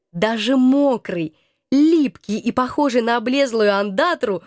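usual speaking voice

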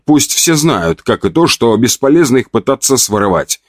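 usual speaking voice

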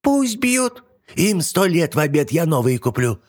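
put-on very high dismissive old hag; old witch voice. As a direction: wave it off like a witch. Yaga brushes off.

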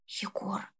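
soft unvoiced whisper of a name. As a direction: breathe his name in alarm. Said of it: "прошептала Алёна". (шёпот)

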